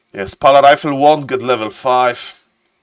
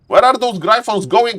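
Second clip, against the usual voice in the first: raised pitch at ordinary volume; milder excitement; 8 semitones higher than usual. Real, not acted. Caster asking after the Gryphons once more, milder excitement. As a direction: repeat it high but more controlled. Same question repeated, less intense.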